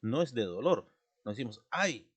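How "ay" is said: demonstrated pained "Ay", raised in pitch; cry of pain. Shown as a demonstration. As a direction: exclaim "Ay" as if hurt. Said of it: "como un dolor". (ay de dolor)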